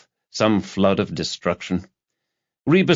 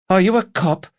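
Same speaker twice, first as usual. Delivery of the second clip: put-on high, louder young woman's voice, suddenly suspicious; alarmed young activist; 12 semitones higher, 4 dB louder than usual. A narrator's reading. Student realising she's talking to a cop, high alarmed voice. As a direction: jump up high and sharp with suspicion. Girl suspects police.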